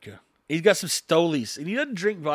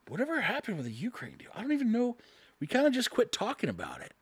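higher, lightly voiced grumbling husband; puzzled husband; 6 semitones higher, 4 dB quieter, faster than usual. Husband missing his dumped vodka, mock puzzled complaint. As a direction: wonder aloud in a higher voice. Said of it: "he's probably saying".

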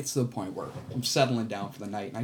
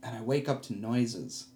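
ordinary delivery

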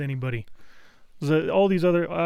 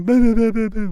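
loud imitated bah bah noises; mock deaf yelling; 4 semitones higher, 6 dB louder than usual. Imitating his deaf coworker's loud bah noises. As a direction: blurt out bah bah, loud and wordless. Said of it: "loudest motherfucker I ever met".

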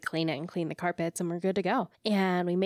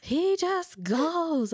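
put-on slow, high drunken drawl; drunk person voice; 9 semitones higher, 3 dB louder, much slower than usual. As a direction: stretch it out like a drunk. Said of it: "your drunk person voice".

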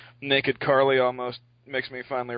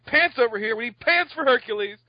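mock yell; 10 semitones higher, 4 dB louder than usual. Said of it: "Gravity is simultaneously yelling".

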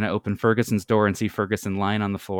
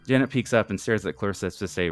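ordinary delivery

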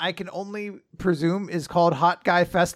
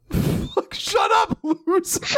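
high, cracking-up mock outburst; hammed-up high-pitched comeback; mock-angry retort; slower than usual. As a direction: snap back in pretend outrage, laughing through it. Acted retort to imagined hero.

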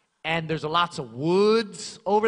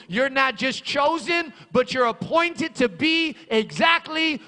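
loud, high, fervent preacher's cry; shouting praise from the pulpit; full-force preaching; 6 semitones higher, 7 dB louder than usual. Acted. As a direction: preach it out to the whole church. Preacher building to a climax.

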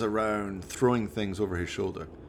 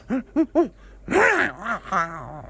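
loud high villain's cackle; villain laughing at his own plight; evil cackle; 13 semitones higher, 6 dB louder than usual. Acted. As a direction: cackle like a mad scientist. Valdemar laughs again.

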